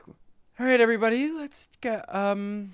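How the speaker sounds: lightly mock a drowsy boss at a meeting; sleepy meeting leader. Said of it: "it's like".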